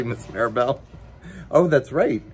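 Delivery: usual speaking voice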